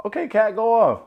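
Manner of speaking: high, loud hype for Kat; hyped-up go off; cheering someone on. Real, not acted. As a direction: egg her on in a high cheer.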